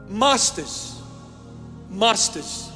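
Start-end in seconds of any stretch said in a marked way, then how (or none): none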